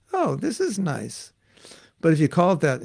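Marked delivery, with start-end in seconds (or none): 0.1-1.3 slow, higher, pleased; mild approving murmur; pleasant surprise. Retold or imitated. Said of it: "then it's like".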